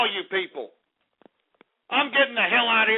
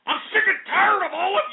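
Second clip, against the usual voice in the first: cracking furious shout; 9 semitones higher than usual. Real, not acted. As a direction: yell it out, voice breaking. Host breaks down in frustration.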